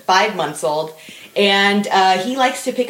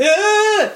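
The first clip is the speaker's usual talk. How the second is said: little scream; 12 semitones higher, 4 dB louder than usual. Retold or imitated. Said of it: "but little screams the whole way".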